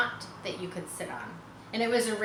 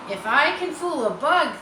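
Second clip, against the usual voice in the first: louder, higher musing; clever spider; 6 semitones higher, 7 dB louder than usual. Acted. Charlotte thinking aloud about fooling a man, sly and higher. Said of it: "thought Charlotte".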